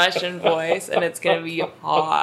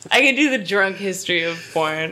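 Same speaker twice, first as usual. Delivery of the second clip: normal talking voice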